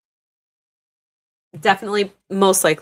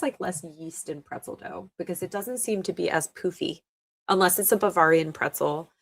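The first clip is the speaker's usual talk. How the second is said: ordinary delivery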